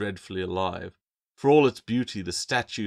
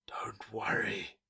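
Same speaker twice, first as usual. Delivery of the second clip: slow, soft whispered creature voice; eerie intoning whisper; 6 dB quieter, slower than usual. A narrator's reading. Statue calming Claire as she carves, slow whisper. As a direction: intone it slowly under your breath. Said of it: "the statue intoned".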